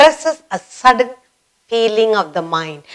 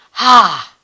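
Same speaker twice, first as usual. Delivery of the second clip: surprised ha. Shown as a demonstration.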